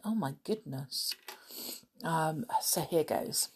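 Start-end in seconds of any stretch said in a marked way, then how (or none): none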